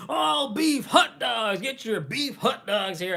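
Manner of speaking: loud, slow vendor shout; hot dog vendor call. Retold or imitated. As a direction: bellow it like a street vendor. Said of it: "he's like".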